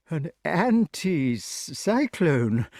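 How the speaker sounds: drawn-out, baffled repeating of anti-cyclone; slow puzzled repeat. Acted. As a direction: mull the strange word over slowly. King puzzling over word.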